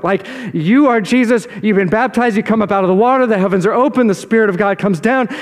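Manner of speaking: ordinary delivery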